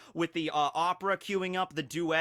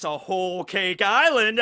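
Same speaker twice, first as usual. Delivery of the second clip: cheesy pun delivery; 3 semitones higher, 9 dB louder, slower than usual. Pretend. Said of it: "that was lame".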